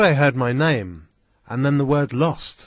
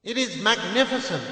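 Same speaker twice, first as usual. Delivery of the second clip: excited cry; 9 semitones higher than usual. A narrator's reading. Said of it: "My uncle was excited".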